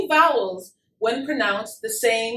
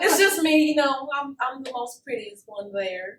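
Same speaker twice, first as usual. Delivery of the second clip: put-on Southern accent. Bragging to the interviewer in character, Southern accent. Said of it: "with this Southern accent".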